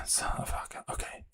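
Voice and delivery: under the breath, almost voiceless; muttering to himself, barely audible; whispered mutter. Real, not acted. Streamer muttering to himself while picking the next clip, whispered.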